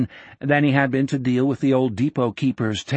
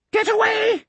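high, shrill-pitched panicked shriek; terrified shriek. A narrator's reading. Old man shrieks at approaching Jack, terrified. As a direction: shriek it out in terror. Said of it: "he shrieked".

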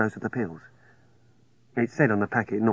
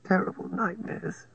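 weak sick voice; 9 semitones higher, 5 dB quieter than usual. A narrator's reading. Sick woman recalling nightmares.

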